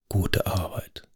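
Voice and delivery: leise und gedämpft raunen; geraunt. A narrator's reading. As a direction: ihm das Lob ganz leise zuraunen. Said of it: "raunte Jennings ihm zu". (leise zugeraunt, fast tonlos)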